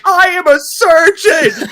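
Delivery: high, slow, tearful imitation; Good Doctor meme voice. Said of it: "like that Good Doctor meme".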